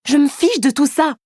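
put-on shouted denial at a jealous boyfriend; yelling. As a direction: fling it out as a shout. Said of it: "hurlai-je".

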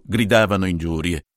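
ordinary delivery, nothing marked

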